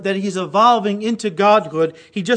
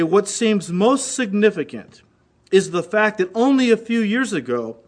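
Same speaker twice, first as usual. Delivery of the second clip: ordinary talk, nothing marked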